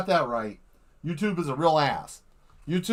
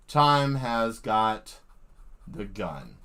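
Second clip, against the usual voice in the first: dramatic drop; 7 semitones lower, much slower than usual. Real, not acted. Ending the stream with a mock grand line, low and slow. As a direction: let it sink low and slow.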